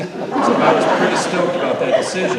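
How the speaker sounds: high, loud, laughing through the joke. Real, not acted. Speaker to the audience, cracking up at his joke. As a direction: deliver the punchline grinning.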